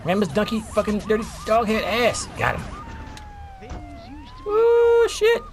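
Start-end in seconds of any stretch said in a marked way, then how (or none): 4.5-5.4 surprised. Real, not acted. Crash reaction.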